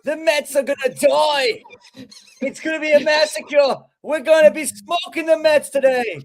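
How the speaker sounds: British impression. [name] hypes the Mets game to the hosts, mock British accent. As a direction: hype the London series in a mock British accent. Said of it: "that was a good British impression".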